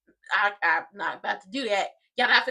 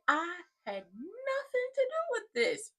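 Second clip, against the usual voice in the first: high, drawn-out whiny protest; mock denial; 8 semitones higher, 6 dB quieter, slower than usual. Joey denying any part to her, mock high protest. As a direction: imitate him protesting innocence, high and stretched.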